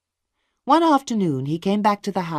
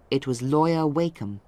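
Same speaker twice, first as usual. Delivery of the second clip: ordinary delivery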